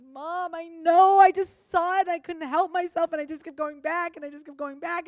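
put-on high, loud, tearful little girl confessing; weeping child. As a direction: plead through tears like a sorry daughter. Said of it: "she starts weeping and weeping".

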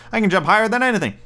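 high, quick storybook boast; boastful little rabbit. A narrator's reading.

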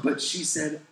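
normal talking voice

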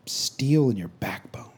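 whispered slowly. Retold or imitated. God whispering to the pastor, slow and hushed. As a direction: breathe the words out. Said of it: "I felt like he said".

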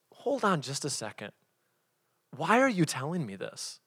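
soft, measured questioning; quietly probing; calm challenge. Shown as a demonstration. Models calmly challenging a gossiper.

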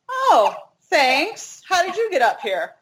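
high-pitched mock-polite retort; put-on sweetness, high; sarcastic thanks. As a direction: feign gratitude, pitch lifted well up. Retold retort to condescending stranger.

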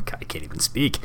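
breathy self-mocking whisper; whispered aside. Real, not acted. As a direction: mutter it under your breath. Flubs word, mutters aside.

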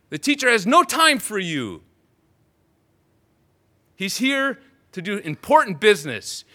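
loud, high angry rebuke; sharp scolding. Acted. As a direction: hush the beggar harshly. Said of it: "their sharp talk".

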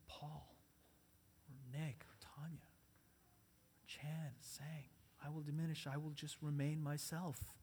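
soft, low, with long pauses; hushed, resigned self-lowering; humble surrender. Shown as a demonstration. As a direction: offer it quietly as a personal prayer. Models humble surrender in Galadriel's words.

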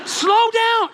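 very high, loud scream; mother screaming. Retold or imitated. Mother to dad at the wheel, screaming. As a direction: scream it from the back seat. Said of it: "Like, she's screaming".

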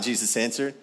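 ordinary talk, nothing marked

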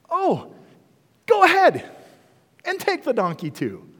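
high, bright, loud "oh, go ahead"; playing the owner who gladly hands it over; cheerful owner. Donkey owner cheerfully gives it away.

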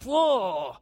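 a sharp, startled whoa at the first sip; mock shocked gasp. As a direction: act out being caught off guard by the taste. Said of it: "you're like".

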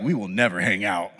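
low, deadpan inner voice; private inner refusal. Retold or imitated. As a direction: drop way down for the secret truth. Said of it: "In the back of your head".

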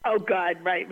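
ordinary talk

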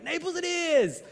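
high and loud, Naples it is; gleeful high-pitched resolve; excited decision. Retold or imitated. Brothers deciding on Naples.

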